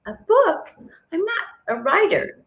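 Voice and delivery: high, stunned "a book?"; mock-shocked self, pitched high; incredulous disbelief. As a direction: react like it's an absurd idea. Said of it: "I was like".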